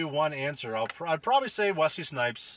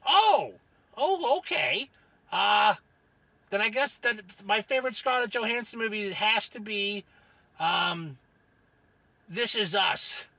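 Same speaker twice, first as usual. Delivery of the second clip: gruff-tempered Rufus character; 6 semitones higher than usual. Acted. Rufus names his favorite actress to host, cranky character voice. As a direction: stretch word endings as an opinionated oddball. Rufus corrected, answers again.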